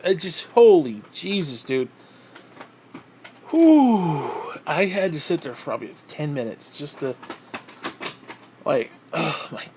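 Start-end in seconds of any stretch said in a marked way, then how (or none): 3.5-4.5 long loud blown-out whew; relieved whew. Real, not acted. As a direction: blow out in relief. Relief after panic.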